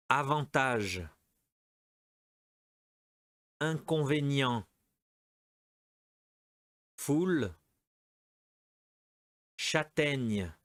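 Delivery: each word spaced and clearly said for the class; careful teacher model for repeating; model pronunciation. Shown as a demonstration. Teacher giving words for students to repeat aloud. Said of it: "Allez, on répète".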